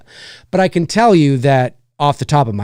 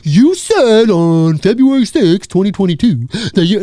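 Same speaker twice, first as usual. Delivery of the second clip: nitpicking commenter voice; 3 semitones higher, 3 dB louder than usual. Retold or imitated. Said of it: "It's like".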